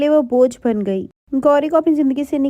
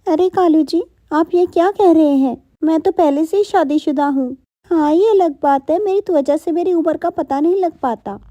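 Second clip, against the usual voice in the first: higher, coy and surprised; surprised Tony voice; 4 semitones higher than usual. Acted. Tony turning down Kalu's proposal, coy and higher. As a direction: play it coy, a little higher.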